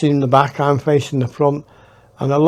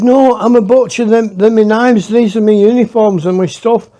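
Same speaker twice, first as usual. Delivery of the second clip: higher and louder, pressing the point; insist, loud and raised; insistent protest; 8 semitones higher, 6 dB louder than usual. Retold or imitated. Butcher protests his innocence to Howard, higher and louder. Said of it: "He said".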